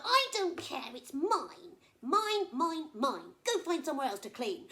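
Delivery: loud, high possessive snapping; angry crocodile. Acted. Crocodile refusing the flamingo, loud and possessive. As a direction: hammer each mine.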